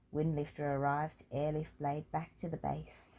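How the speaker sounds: ordinary delivery, nothing marked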